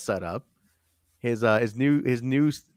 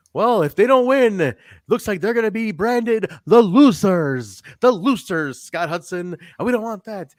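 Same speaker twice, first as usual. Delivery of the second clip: Scott Hudson; 8 semitones higher, 5 dB louder than usual. Retold or imitated. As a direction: ham it up as a corny interviewer, higher and louder. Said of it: "He's so cheesy".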